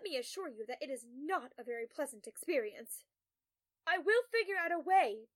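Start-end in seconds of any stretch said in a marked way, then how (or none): 3.9-5.3 loud, high, desperate call. A narrator's reading. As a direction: shout it back while being dragged away. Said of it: "Tom calls as the guards drag him".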